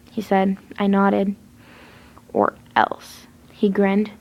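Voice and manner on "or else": a low menacing warning; sinister Jeff. A narrator's reading. Jeff threatens.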